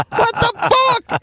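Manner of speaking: high, startled mock cry; shocked outburst. Chief spotting snake on suspect's arm, shocked high cry. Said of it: "The chief is like".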